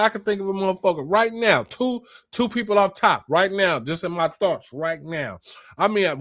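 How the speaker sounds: usual speaking voice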